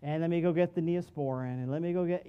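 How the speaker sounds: a light take on a mom coddling a scraped knee; lightly voiced mother fussing over a boo-boo; doting mom. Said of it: "You know what mom would do?".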